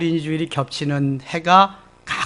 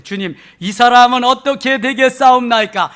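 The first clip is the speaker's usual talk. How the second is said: loud, raised reading of Peter; Peter's loud plea; 7 semitones higher, 10 dB louder than usual. Acted. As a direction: call it out to the Lord. Peter's question read aloud.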